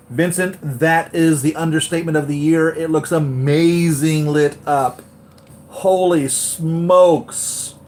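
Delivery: emphatic, awestruck rave; amazed gushing. Real, not acted. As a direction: gush loudly, stretching the big words. Gushing back at a viewer's comment.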